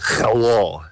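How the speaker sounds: guttural Russian H on "hello"; "hello" with a phlegmy kh at the start; Russian accent. Shown as a demonstration. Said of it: "it was very, like".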